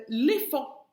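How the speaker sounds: usual speaking voice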